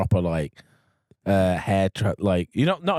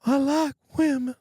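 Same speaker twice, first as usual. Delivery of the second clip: high put-on voice slipping southern; his voice, drifting too southern English; killer's voice; 14 semitones higher than usual. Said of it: "I went a bit too southern then".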